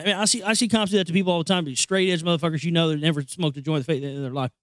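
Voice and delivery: usual speaking voice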